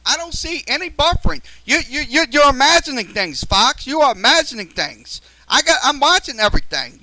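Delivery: fed up, shouting back at the viewer; real yelling at a viewer about buffering. Real, not acted. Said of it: "I'm yelling at somebody on there".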